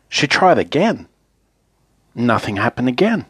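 ordinary delivery, nothing marked

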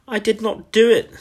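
high, loud schoolgirl scream; screamed protest. A narrator's reading. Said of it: "she screamed".

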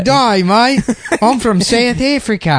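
high, hammy fake Australian-style South African accent; bad South African accent. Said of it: "That's not how South Africans sound".

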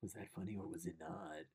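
low, soft self-doubting mutter; insecure inner voice. Retold or imitated. Comic on a Zoom call doubting himself, low inner mutter. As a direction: murmur your doubts to yourself. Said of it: "You're like".

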